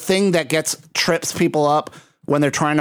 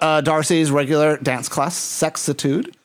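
usual speaking voice

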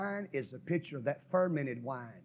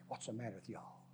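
low grumble; 4 semitones lower, 13 dB quieter than usual. Retold or imitated. Winos spitting out juice.

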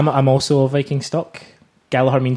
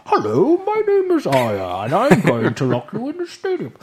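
slow, high swooping villain voice; theatrical masked-villain announcement, drawn out; Bane; 8 semitones higher, slower than usual. Acted.

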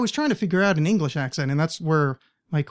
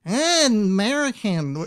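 drawn-out mock American accent, higher; awkward American accent; 4 semitones higher, slower than usual. Host mimicking Alan's weird attempt, awkward mock American. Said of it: "it was this really awkward noise".

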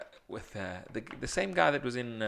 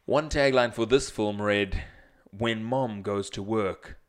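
slow, louder trailer narrator read; movie trailer voice; 5 dB louder, slower than usual. Acted. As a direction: boom it out like a trailer. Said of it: "in your movie, movie guy voice".

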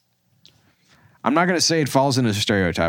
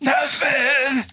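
high-pitched laughing fit; laughing helplessly. Real, not acted. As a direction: squeal the words through laughter. Breaks up laughing at the joke.

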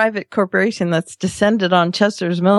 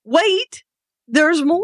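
high, pitchman's "wait, there's more"; infomercial; 8 semitones higher, slower than usual. Pretend. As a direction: sell it like a TV pitchman. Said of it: "It's like an infomercial".

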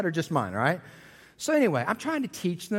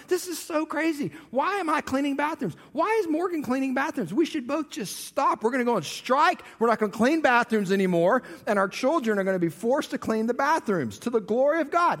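mock rant; 7 semitones higher than usual. Mock rant about strike.

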